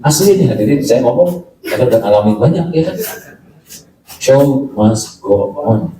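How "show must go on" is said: slow motto. Shown as a demonstration. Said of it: "slow English phrase".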